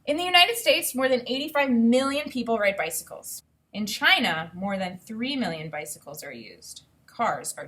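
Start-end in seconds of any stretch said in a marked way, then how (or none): none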